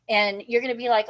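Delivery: ordinary talk, nothing marked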